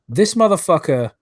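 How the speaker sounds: real rage at the screenshot; angry outburst. Real, not acted. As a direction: spit the insult out in real anger. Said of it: "I'm so angry I can't even speak".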